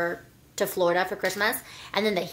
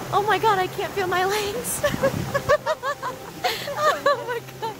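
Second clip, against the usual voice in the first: high laughing fit, can't stop; helpless laughing; 12 semitones higher than usual. Real, not acted. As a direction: laugh out of control. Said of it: "just start laughing for no reason".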